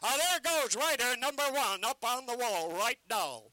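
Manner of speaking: loud ballyhoo, selling hard to a crowd; carnival barker pitch. Acted. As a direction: bark the show to passers-by.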